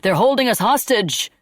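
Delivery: loud, high desperate yell; shouted. Acted. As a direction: call out to the crowd below in panic. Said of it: "they shouted".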